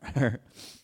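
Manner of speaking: a short warm laugh; warm chuckle. Real, not acted. Father laughing about the dumb bear with his boys. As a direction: chuckle fondly at the memory.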